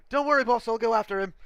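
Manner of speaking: eager guard. Acted. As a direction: chirp it up keen to please the boss.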